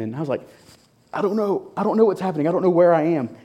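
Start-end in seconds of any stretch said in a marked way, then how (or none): 1.1-3.3 high, lost and scared; bewildered panic. Retold or imitated. To the booking officer he grew up with, high bewildered panic. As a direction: sound completely at a loss.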